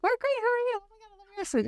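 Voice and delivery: high, sing-song mock delight; gushing New Yorker. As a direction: gush back like a thrilled stranger. New Yorkers answering a greeting.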